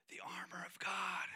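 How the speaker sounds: hushed, voiceless prompt; stage whisper. Retold or imitated. Wife prompting kids from another room, stage whisper.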